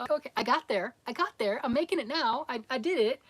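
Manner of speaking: a touch of proud self-defence; mildly triumphant. Acted. Voicing herself finally succeeding.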